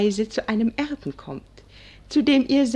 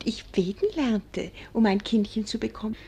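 hushed whisper, speeding up at the end; whispered aside; 5 dB quieter than usual. Acted. Wife to husband, confiding how she prayed for a child, whispering. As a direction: lean in and breathe it like a secret.

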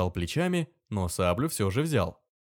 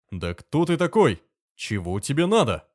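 loud, higher indignant outburst; 5 semitones higher, 5 dB louder than usual. Acted. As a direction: demand it, outraged at the insolence. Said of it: "возмутился воевода".

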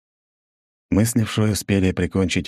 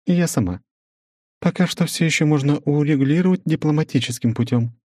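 measured, higher female voice; woman's voice; 9 semitones higher than usual. Acted. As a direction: narrator voicing a businesswoman, higher. Svetlana voiced.

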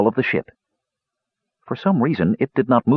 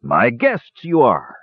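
slow, hearty dwarf voice; Dugan's lusty cry; 5 semitones higher, slower than usual. A narrator's reading. Dwarf greeting captives through the hatch, slow and hearty. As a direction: savour each word like a grand host. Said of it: "cried Dugan Redhammer lustily".

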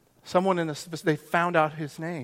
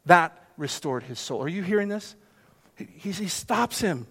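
ordinary delivery

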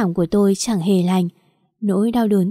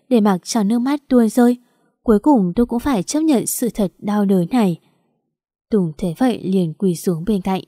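ordinary delivery, nothing marked

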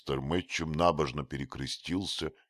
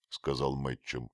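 ordinary delivery, nothing marked

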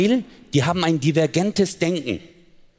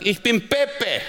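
higher, proud gangster announcing his name; menacing reveal; 7 semitones higher, much slower than usual. Retold or imitated. Gangster showing his gun to me, proud reveal. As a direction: announce yourself like a mob boss.

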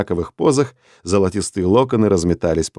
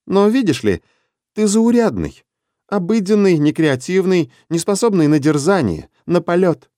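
Vera's voice; 8 semitones higher than usual. Acted. Vera lectures Denis coolly.

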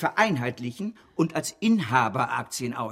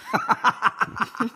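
gloating laugh; 10 semitones higher than usual. Acted. Banker mocks his partner's conscience, laughing.

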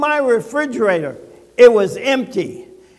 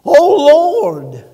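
mock southern preacher; 6 semitones higher, 7 dB louder than usual. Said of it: "Do I need a southern accent?".

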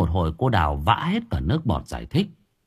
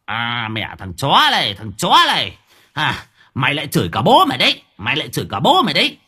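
put-on louder, faster angry old man; spiteful old man; 4 semitones higher, 4 dB louder than usual. A narrator's reading. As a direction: spit the insults at the boy. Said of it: "Tay Hến lúc này hằn học". (lão Hến hằn học, gằn to và nhanh)

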